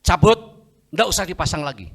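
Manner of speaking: loud, high command; firm demand. Retold or imitated. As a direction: order the doctor with full conviction. Acted firm order to the doctor.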